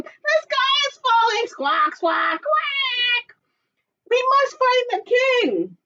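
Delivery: high squawky alarm; squawking bird voice. Acted.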